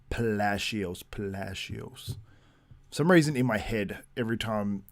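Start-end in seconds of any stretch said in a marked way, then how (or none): 0.1-2.2 "Palacios" said twice with a playful lilt, fading off; "Palacios" rolled playfully, the second one quieter; playful name repetition. Shown as a demonstration. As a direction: savour saying "Palacios", trailing softer. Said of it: "I just go Palacios".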